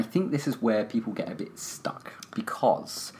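soft whispered aside; whispering. Real, not acted. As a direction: drop to a whisper. Sudden hushed aside.

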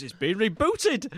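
normal talking voice

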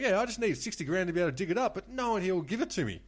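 high, loud, aggrieved insistence; retold earnest plea. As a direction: imitate an indignant crank making his case. Retold weirdo earnestly pleading his case.

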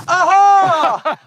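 mate waking up fully clothed, whooping; gleeful aha. Retold or imitated. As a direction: burst out in triumph. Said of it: "He's gone".

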